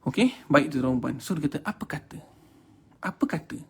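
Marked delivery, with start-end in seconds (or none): 1.6-2.2 devil's whisper. Acted. Devil whispers to [name], hushed whisper. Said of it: "dia bisik punya hal".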